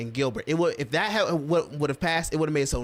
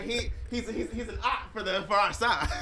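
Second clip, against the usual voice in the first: laughing mock quote; 4 semitones higher, 4 dB quieter than usual. Mocking critics' voice while laughing.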